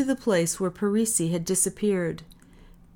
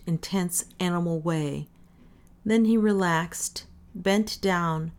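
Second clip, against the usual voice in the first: ordinary delivery, nothing marked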